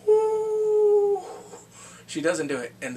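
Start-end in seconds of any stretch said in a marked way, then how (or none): 0.1-1.2 long high loud "woo"; excited whoop. Real, not acted. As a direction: whoop it out and hold it. Excited whoop while recapping.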